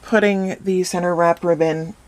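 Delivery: ordinary talk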